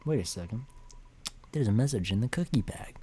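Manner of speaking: ordinary talk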